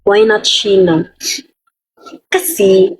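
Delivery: ordinary talk, nothing marked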